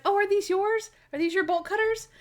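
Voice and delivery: chirpy helpful woman who suspects nothing; high naive helper voice; innocent Natalie. Retold or imitated. Natalie voiced innocently helping thief.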